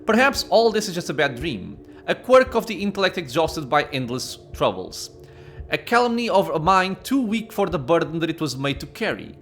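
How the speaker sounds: measured storyteller reading; story narration. A narrator's reading. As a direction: narrate the scene like an audiobook. Reads game narration.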